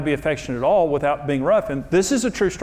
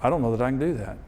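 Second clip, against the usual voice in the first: anxious thought; 5 semitones lower, 8 dB quieter than usual. Retold or imitated.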